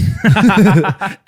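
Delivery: loud high burst of laughter. Real, not acted. Laughing at the scene.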